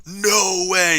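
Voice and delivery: demonstrating an epic read-out, long held vowels; big epic voice. Shown as a demonstration. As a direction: boom it out in an epic voice. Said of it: "but I have a deep voice".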